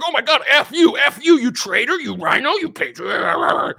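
very high, loud outraged mock yell; mock furious fan. Fans raging at someone calling out Trump, high loud mock fury. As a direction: rage at the traitor like a fan.

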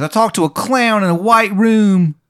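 high-pitched fake Baltimore accent; fake Maryland accent. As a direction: put on a bad Maryland "hun" voice. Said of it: "he's faking being from Maryland".

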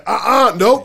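loud, high scolding refusal; acted-out firm command to a dog; shooing the dog. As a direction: cut the dog off sharply.

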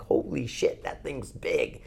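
hushed, stunned whisper; awed whisper. Retold or imitated. Hunter seeing the mother bear rise, whispered shock. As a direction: breathe it out in shock.

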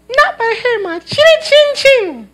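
very high, slow piggy squeak; squeaky little pig. Acted. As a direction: draw it out like a tiny pig. Pig answers the wolf.

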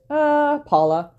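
drawn-out "uh" before the name; hesitant. Retold or imitated. Co-host imitating a man asked who is prettiest, hesitant. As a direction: stall briefly, then give the name. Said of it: "I think he didn't even hesitate".

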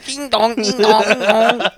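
mock doorbell chime. Mock doorbell sound effect.

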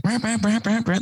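high revving engine noise, brap brap; imitated engine brap. Snowmobiles ripping past him, imitated high engine braps. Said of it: "they're like".

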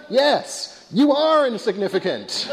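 high-pitched, emphatic confirmation; ant therapist. Acted. Therapist gives ant patient the punchline, high and emphatic. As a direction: deliver the verdict high, as good news. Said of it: "the ant therapist says".

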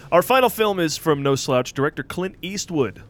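a hint of an announcer introducing a film; lightly voiced movie host; host read. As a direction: present the film like a show host.

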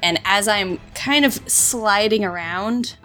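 usual speaking voice

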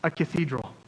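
slow, savoring each word with a smile; a worker proudly marveling at his calling; happy and awed. Retold or imitated. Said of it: "he's smiling, he's happy, he goes".

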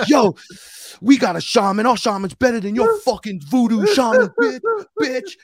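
loud, high street-trash-talk yell; gangsters yelling. Retold or imitated. Rival gangsters boasting about their shaman in the street, yelling. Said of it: "You'd hear them yelling at each other".

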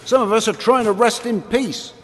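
high, loud, irritated; indignant. Acted. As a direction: complain like a disturbed ghost. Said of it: "I said".

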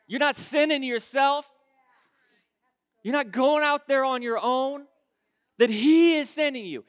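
loud, punched preacher's rebuke, slowing on each "say"; full-force preaching. Real, not acted. God tells the youth what not to say, forceful preaching. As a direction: hammer each command home to the congregation.

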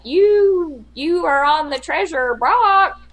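high squawky parrot, slowed right down. Retold or imitated. Retelling the ride's parrot to friends, high and slow. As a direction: squawk it out like a theme park parrot. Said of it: "a parrot says something like".

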